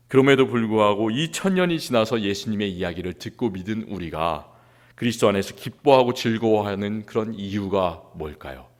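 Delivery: ordinary delivery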